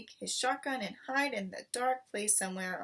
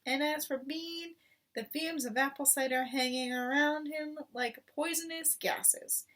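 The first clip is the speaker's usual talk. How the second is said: higher, scornful bragging; cocky boast; 6 semitones higher than usual. A narrator's reading. Mister Fox bragging to his wife, scornful. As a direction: sneer at the stinking farmers.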